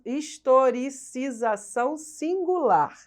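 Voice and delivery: historicização singular drawn out in careful syllables; slow, careful enunciation. Real, not acted. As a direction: take "historicização singular" slowly, piece by piece. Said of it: "tem que falar devagar".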